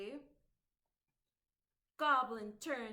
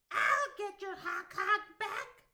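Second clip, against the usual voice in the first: high, louder goblin promise; determined goblin; 8 semitones higher, 6 dB louder than usual. Acted. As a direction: promise it like a brave little goblin.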